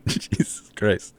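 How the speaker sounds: whispered through a laugh, slow; laughing at the court report, whispered disbelief; breathless laughing disbelief. Real, not acted. Disbelieving at article.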